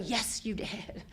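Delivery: soft, slow loving praise; proud grandma. Retold or imitated. Nana praising her grandson for his win, tenderly. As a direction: praise him gently, taking your time. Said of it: "I'm gonna go".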